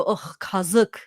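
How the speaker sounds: ugh, kazık with a sneer of disgust. Retold or imitated. Telling mom about ripped jeans, disgusted. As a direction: spit out kazık like the jeans were a scam. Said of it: "And I go".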